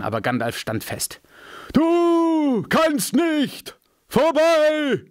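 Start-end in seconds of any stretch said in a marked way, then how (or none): 1.7-5.0 very slow, much higher, louder ringing proclamation; wizard's defiance. A narrator's reading. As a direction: hold the bridge against the demon. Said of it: "sagte er".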